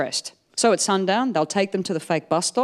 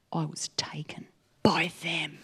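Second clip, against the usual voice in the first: spooky whisper; 3 semitones lower, 9 dB quieter than usual. Retold or imitated. Grandma's alien abduction story.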